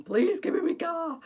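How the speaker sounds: high pleading mock whine. Demanding her new car at the dealer, high mock plea. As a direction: beg like a desperate customer. Banging the door down.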